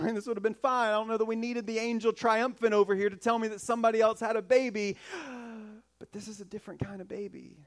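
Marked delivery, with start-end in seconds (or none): 5.0-5.7 mock gasp. Mock awe before revelation.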